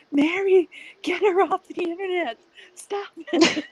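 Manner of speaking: high urgent yell at Mary; shouting. Retold or imitated. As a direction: call out sharply and loud. Calling out to Mary.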